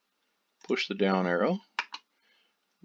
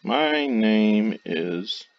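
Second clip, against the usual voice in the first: very slow, drawn-out words with long pauses; slow dictation; 6 dB louder, much slower than usual. Shown as a demonstration. Typing the computer voice's script aloud, slow dictation. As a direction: spell it out slowly as if typing along. Dictating text typed for speech editor.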